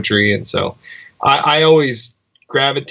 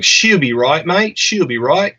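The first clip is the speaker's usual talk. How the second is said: higher, put-on Australian accent; 5 semitones higher than usual. Musician quoting Gold Coast locals' saying, Australian accent. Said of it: "you nailed that accent".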